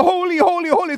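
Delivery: high, loud ringing cry; angels crying holy. Acted. Angels crying out before God, high loud cry. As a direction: ring out like angels. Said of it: "he hears them cry out".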